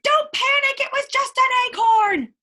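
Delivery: high, loud cheerful chicken; excited chick calming a friend; loud chicken call. Acted. Chicken reassures loudly.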